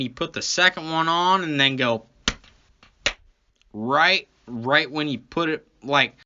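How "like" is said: ordinary talk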